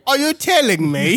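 high, hammed-up British delivery; British accent. Friends redo Rush Hour lines British-style, high British accent. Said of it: "with a British accent".

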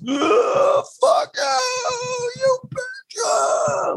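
put-on very high, shrieking drunk yell; drunken yelling. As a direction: scream it like a raging drunk on a tape. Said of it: "an audio of him drunk going like".

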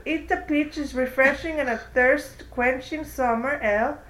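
reading a beer label like an ad. Acted. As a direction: sell it like a commercial. Reads beer ad description.

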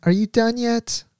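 high, sultry calling; sexy voice. Retold or imitated. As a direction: coax her husband seductively. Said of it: "He's like".

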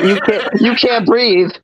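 high-pitched, laughing through the words. Real, not acted. Teasing the pinned student's plight, laughing.

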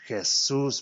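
"Jesus" said hey-SOOS, rising; "Jesus" with Spanish h and stressed SUS; Spanish pronunciation. Shown as a demonstration. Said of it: "That's how you pronounce it".

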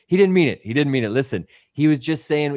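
loud, high opening plea; urgent mock placating; apologizing. Pleading with the giant for his buddy, urgent apology. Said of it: "So as I'm apologizing".

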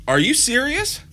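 jumps way up in disbelief; can't believe what he's hearing, pitch soaring; retold shocked dad; incredulous. Dad stunned by son's question.

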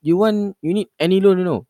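scam caller voice. Retold or imitated. Caller voice vs own reply.

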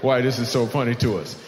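usual speaking voice

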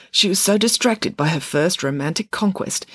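ordinary delivery

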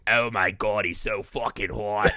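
low chain-smoker actress impression; Kathleen Turner. Host mocking the actress's voice now, low impression. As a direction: drop low, a smoked-out star voice. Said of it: "That's her voice now, not the movie".

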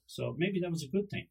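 ordinary talk, nothing marked

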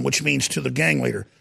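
normal talking voice

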